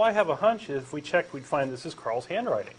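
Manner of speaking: normal talking voice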